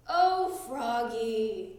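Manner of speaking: slow, drawn-out weary groan. A narrator's reading. Dad groaning at forgetful Froggy. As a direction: let it sag out of you. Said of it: "groaned his dad".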